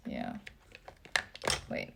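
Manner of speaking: normal talking voice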